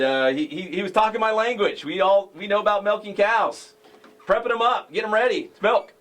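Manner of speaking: ordinary delivery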